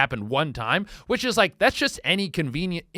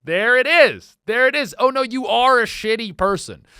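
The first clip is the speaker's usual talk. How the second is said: gotcha realization; 6 semitones higher, 8 dB louder than usual. Retold or imitated. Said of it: "And you go".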